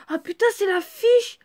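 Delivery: as if you just found something odd; real surprise at the card in the drawer; sudden discovery. Real, not acted. Finds a card in her drawer.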